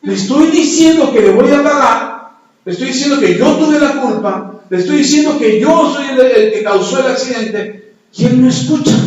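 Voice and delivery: a preacher exasperated that the biker won't listen; exasperated loud complaint. Real, not acted. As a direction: vent the frustration, raising the voice. Frustrated retelling of the argument.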